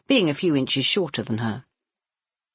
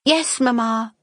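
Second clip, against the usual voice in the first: sisters in unison; 10 semitones higher, 3 dB louder, slower than usual. Acted. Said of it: "the three sisters said in unison".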